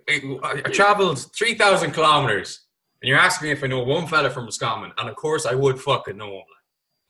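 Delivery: normal talking voice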